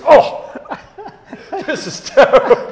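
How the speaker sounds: loud, high squeal of disgust breaking into laughter; laughing disgust. Retold or imitated. Mike tasting the awful beer on camera, laughing disgust. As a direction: spit it out and crack up. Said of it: "and he goes".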